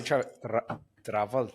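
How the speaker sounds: travel with a trilled R; imitated rolled R. Comic copying the host's way of saying travel, rolled R. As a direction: roll the R right after the T in travel. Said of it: "the way you said travel".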